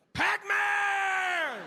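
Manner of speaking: high, drawn-out mock yell; calling out. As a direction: holler the nickname, stretching the last syllable way out. Friend calls out to the athlete.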